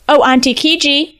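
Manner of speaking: high-pitched coaxing call; lightly acted storybook hail. A narrator's reading. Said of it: "Shako called".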